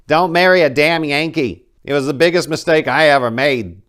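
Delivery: loud, high-pitched mock old grandmother; grandmother's warning. Grandmother on the phone warning her grandson, loud mock granny voice. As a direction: belt it out like a fed-up Southern granny. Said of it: "She would say".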